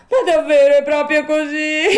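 high, loud puppet wail ending in sobs; sobbing. A narrator's reading. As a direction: ask through hammed-up sobs. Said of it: "domandò singhiozzando il burattino".

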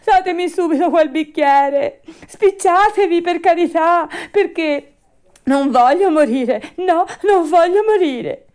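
high, loud, frantic begging; panicked shrieking. A narrator's reading. Puppet begs for the glass, afraid to die, shrieking. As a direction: plead at the top of a puppet's lungs. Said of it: "cominciò allora a strillare il burattino".

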